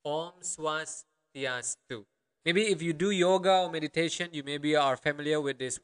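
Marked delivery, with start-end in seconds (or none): 0.0-2.1 Om Swastiastu drawn out in separated syllables; slow syllable-by-syllable. Shown as a demonstration. Teacher breaking a long greeting down for learners, slow and segmented. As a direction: say Swastiastu slowly, pausing between its parts. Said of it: "This is rather long".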